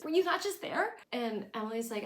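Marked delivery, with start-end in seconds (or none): 0.0-1.0 much higher, louder indignant squeal; mock outrage at her mother; hammed-up disbelief; imitating Lorelai. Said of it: "And Lorelai says".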